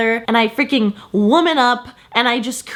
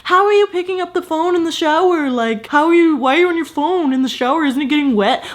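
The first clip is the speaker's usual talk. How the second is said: a higher, needling mock voice of a nosy commenter; 5 semitones higher than usual. Mocking her viewers' comments, higher needling mock voice. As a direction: parody the skeptical viewer asking. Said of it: "comments that are like".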